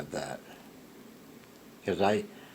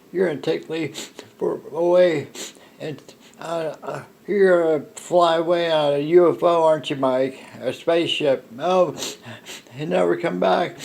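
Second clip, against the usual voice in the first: higher and louder, imitating a distraught wife; imitating Darla crying; 7 semitones higher, 7 dB louder than usual. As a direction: mimic her anxious pleading. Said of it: "Missus Miller started crying".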